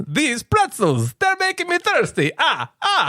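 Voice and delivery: theatrical counting vampire voice; hammed-up cartoon vampire count; fifth character voice. Shown as a demonstration. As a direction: draw it out like Count Chocula. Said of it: "The fifth one is".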